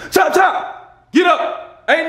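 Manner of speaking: put-on dad hurrying kids to work; mock order shout. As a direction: bark orders like a drill sergeant.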